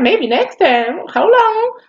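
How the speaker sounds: springing the surprise, loud and high; gleeful reveal. Retold or imitated. Telling mom the baby is a girl after tricking her, gleeful. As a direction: pitch jumps way up on hold on. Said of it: "I'm like".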